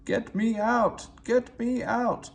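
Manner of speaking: high, slow desperate plea; a stuck engine wailing for help; pleading. Acted. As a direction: beg to be pulled free.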